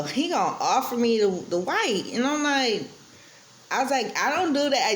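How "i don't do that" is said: retold outburst, pitched well up; going off on him. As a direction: snap back at the bartender, offended. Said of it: "Yeah, I went off on him".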